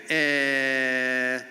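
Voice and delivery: "eh" as a clipped Israeli filler sound. Shown as a demonstration. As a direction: give "eh" as an Israeli filler, not "um". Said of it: "Israelis don't say, um, they say".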